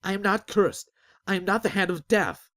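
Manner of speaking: high, loud desperate denial; shouted denial. Acted. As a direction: shout it at the whole crowd. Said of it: "he shouted".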